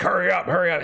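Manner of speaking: high-pitched mock urgency; mock rushing doctors. Impatient doctors rushing the nurses, high mock urgency. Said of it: "doctors are on us being like".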